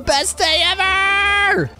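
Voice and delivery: very high, drawn-out squeal of joy; overjoyed yell. Pretend. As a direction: stretch every word in giddy triumph. Thrilled about getting the surprise.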